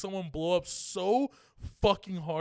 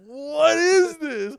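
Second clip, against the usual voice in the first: much higher, louder, drawn out slowly; baffled disbelief; 12 semitones higher, 13 dB louder, much slower than usual. Retold or imitated. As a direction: stretch it out in stunned confusion. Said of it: "And I was just like".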